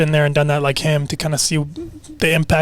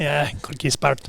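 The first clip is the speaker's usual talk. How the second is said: put-on Scottish accent; 5 dB quieter than usual. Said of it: "great name in a Scottish accent".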